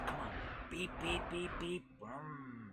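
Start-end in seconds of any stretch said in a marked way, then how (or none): 0.7-1.8 horn noise. Pretend. Imitating car horn.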